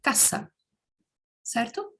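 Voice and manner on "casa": casa with a crisp hissed S, never a Z; Spanish s in casa. Shown as a demonstration. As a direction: say casa with a clean S sound. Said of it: "em espanhol, nós falamos".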